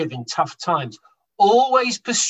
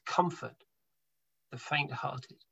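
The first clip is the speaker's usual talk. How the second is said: hushed and tender, much softer; a soft, comforting repeat of the verse; 4 semitones lower, 12 dB quieter than usual. Shown as a demonstration. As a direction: ease the words out gently to someone hurting. Repeats verse gently to listeners.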